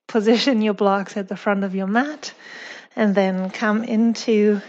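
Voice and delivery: ordinary delivery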